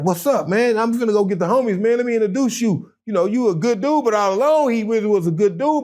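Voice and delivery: excited greeting. Retold or imitated. Greeting old friend, retold.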